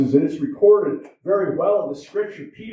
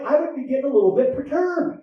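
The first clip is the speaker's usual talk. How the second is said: normal talking voice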